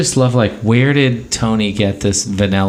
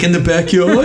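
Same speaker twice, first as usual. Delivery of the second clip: mock puzzled Tony; 9 semitones higher, 3 dB louder than usual. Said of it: "he's like".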